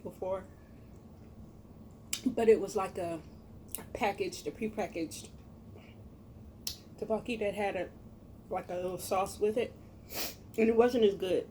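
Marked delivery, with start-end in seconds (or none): none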